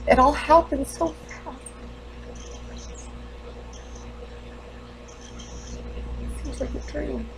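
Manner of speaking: high, strained grief with long halting pauses; grieving, guilt-stricken mother. Acted. Mother realizing the children are gone forever, anguished. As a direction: let the pitch climb into self-blame. Character grieves and blames herself.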